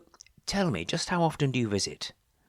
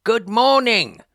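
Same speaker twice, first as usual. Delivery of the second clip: bright booming good morning, pitched way up; loud cheery greeting; 10 semitones higher, 10 dB louder than usual. Acted. Vicar booming a greeting at a student's door. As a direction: call it out to wake a sleepy student.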